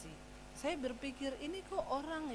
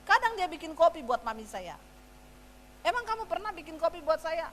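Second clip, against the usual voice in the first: loud, higher scolding of husband; angry wife; 5 semitones higher, 7 dB louder than usual. Retold or imitated. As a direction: tell him off in a heated quarrel. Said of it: "Saya bilang sama suami saya". (omelan istri yang marah ke suami)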